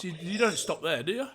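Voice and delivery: normal talking voice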